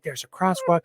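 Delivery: high, preachy imitation of the lady; high mock scolding about the crosswalk; mock lecturing Karen. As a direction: lecture like a scolding stranger, mocked. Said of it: "trying to lecture me".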